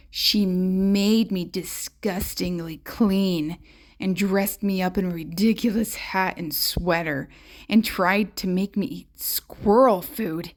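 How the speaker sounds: ordinary delivery, nothing marked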